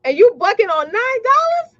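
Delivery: high, disbelieving jab; incredulous. Real, not acted. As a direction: scoff at the nerve of it. Scolding stingy clients.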